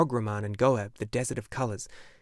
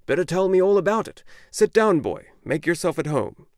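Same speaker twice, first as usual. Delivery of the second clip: bookseller's voice, higher and louder; old bookseller; 7 semitones higher, 9 dB louder than usual. A narrator's reading. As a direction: insist the boy stay.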